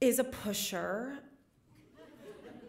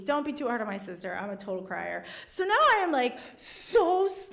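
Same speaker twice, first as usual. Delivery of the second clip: normal talking voice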